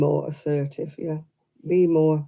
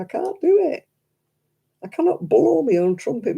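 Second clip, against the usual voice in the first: normal talking voice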